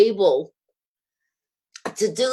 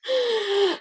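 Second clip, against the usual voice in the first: short high-pitched laugh; a laugh that covers nerves; nervous high laugh. Real, not acted. Recalling the chemo door, a high nervous laugh.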